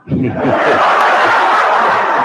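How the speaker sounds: silent wheezing laugh; breathless laugh. Real, not acted. Teller cracking up at own story, breathless wheeze. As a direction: laugh hard with no voice.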